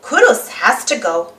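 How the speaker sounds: ordinary delivery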